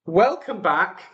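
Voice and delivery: ordinary talk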